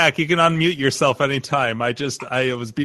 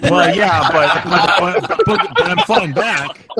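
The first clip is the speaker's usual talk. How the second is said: laughing; 6 semitones higher, 6 dB louder than usual. Real, not acted. Laughing protest about flying back.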